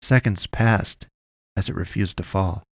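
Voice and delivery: plain reading voice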